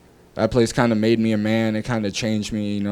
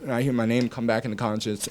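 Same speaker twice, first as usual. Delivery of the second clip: ordinary talk, nothing marked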